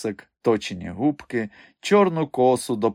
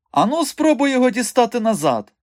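high, loud, eager dare; suddenly fired up; 13 semitones higher, 5 dB louder than usual. A narrator's reading. Mikha urging the strongman about the nail, suddenly fired up. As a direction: burst out with a sudden idea. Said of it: "раптом загорівся Міха".

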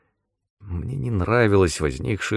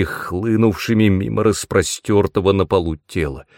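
ordinary delivery

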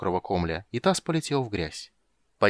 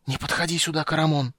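terrified whisper; 6 semitones higher than usual. Acted. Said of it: "страшным шёпотом проговорил Кендер".

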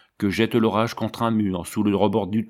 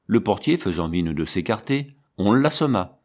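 ordinary delivery

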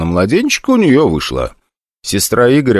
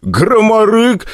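laughing mock; 14 semitones higher, much slower than usual. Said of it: "не смог сдержать смех".

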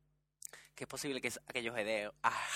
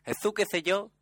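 "Jesús, que sé yo" with lisped ceceo s; lisping Andalusian accent; 7 semitones higher, 3 dB louder than usual. Shown as a demonstration. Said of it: "Hoy voy a hablar con la Z".

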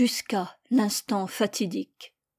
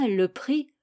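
plain reading voice

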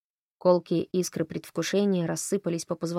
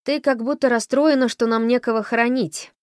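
louder, higher annoyed remark; irritated; 5 semitones higher, 6 dB louder than usual. A narrator's reading. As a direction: snap at the girl in irritation. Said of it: "заметила она раздражённо".

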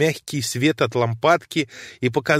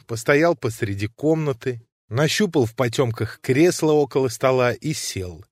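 usual speaking voice

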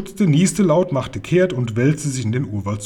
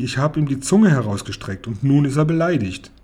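ordinary delivery, nothing marked

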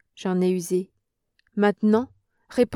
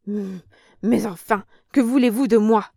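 cornered; 5 dB louder than usual. Acted. Old woman cornered, pleading.

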